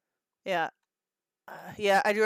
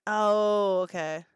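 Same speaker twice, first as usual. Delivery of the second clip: normal talking voice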